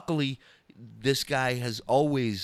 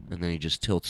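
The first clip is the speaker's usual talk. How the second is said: ordinary talk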